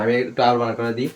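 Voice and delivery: usual speaking voice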